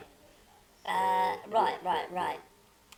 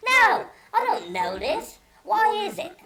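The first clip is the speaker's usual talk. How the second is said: much higher and louder, stretched slow on the first words; hammed-up bewildered friend; mock confused Rob; 13 semitones higher, 7 dB louder, slower than usual. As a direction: play Rob baffled and squeaky. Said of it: "And he goes".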